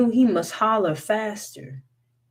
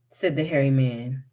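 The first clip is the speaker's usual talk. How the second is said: ordinary delivery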